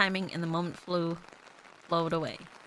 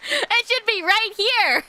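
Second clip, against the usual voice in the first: laughing protest; 13 semitones higher, 8 dB louder, slower than usual. Real, not acted. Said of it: "joking protest at the game".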